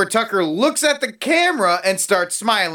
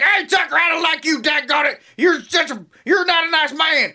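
mock Montana twang; 10 semitones higher than usual. Mocking how a real Montana man would yell at Tucker, high twangy shout. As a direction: holler it like a riled-up Montana local. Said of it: "They'd be like".

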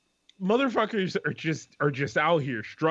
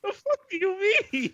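incredulous. Retold or imitated. Stranded passenger to the bus agent, high and incredulous. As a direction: throw it up high in disbelief. Retelling outrage at the delayed bus.

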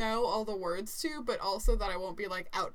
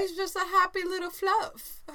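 cooing; 9 semitones higher, 6 dB louder than usual. Real, not acted. Cooing about the fluffy dog.

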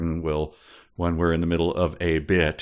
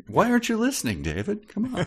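mock whiny plea; 13 semitones higher, faster than usual. Mock pleading with David.

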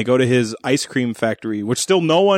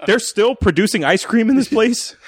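high-pitched, laughing incredulity; shocked disbelief; 8 semitones higher than usual. Retold or imitated. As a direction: question it as if utterly baffled. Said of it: "That's what shocked me".

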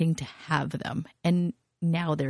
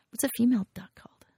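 whisper; 5 semitones higher, faster than usual. Real, not acted. Whispered aside to herself.

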